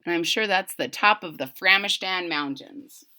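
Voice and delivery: usual speaking voice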